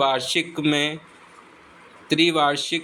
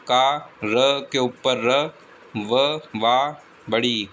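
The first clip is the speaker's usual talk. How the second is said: slow spelling out; much slower than usual. Shown as a demonstration. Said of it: "spelling out slowly".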